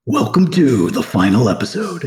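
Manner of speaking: a hint of a suave old film star; lightly voiced movie trailer intro. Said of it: "the old movie voice".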